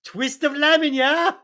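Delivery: hammed-up British order; British accent. Holmes adds lemon, bad British accent. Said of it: "worst British accent I've ever heard".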